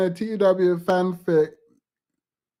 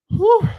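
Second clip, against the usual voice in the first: loud high whew; 14 semitones higher, 5 dB louder than usual. Real, not acted. Reacting to ridiculous take.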